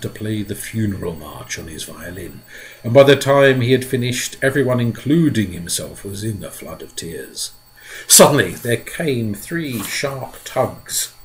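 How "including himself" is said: ordinary delivery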